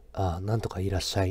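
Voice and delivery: low seductive streamer voice; put-on heartthrob voice. Shown as a demonstration. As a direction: drop the pitch and croon it lazily, close to the mic. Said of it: "イケボを作れるよ".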